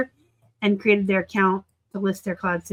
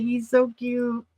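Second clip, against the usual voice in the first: ordinary delivery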